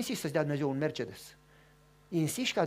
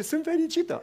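high, tearful happy voice; a woman weeping with joy; lightly mimicked tearful joy; crying woman; 11 semitones higher, 4 dB louder than usual. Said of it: "și plângea".

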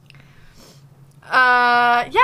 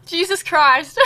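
startled; 5 semitones higher, 7 dB louder, slower than usual. Real, not acted. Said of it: "That was so loud for me".

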